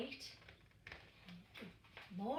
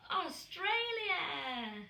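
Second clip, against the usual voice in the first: drawn-out reveal; 7 semitones higher, 4 dB louder, much slower than usual. A narrator's reading. Dramatic reveal to children.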